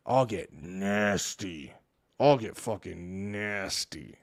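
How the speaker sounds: a playful villain threat; lightly voiced growling threat; mock menacing. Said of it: "where I turn into a fucking nasty shit".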